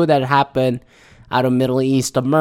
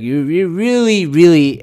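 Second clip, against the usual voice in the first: ordinary talk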